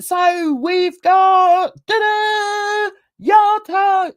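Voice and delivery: high, loud, drawn-out fanfare; hammed-up reveal of a guest; mock announcer. Mimicking a streamer unveiling her guest, showy high announcement. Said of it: "and then she said".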